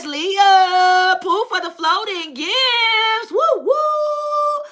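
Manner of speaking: drawn-out singsong cheer, climbing high; stretched thanks rising into high woos; celebratory whooping. Real, not acted. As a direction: whoop it up for the gift. Celebrating a viewer's gift.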